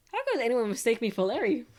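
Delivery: loud, affronted exclamation; indignant protest. Acted. Lawyer mistaken for Larry, indignant. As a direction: protest it loudly, offended.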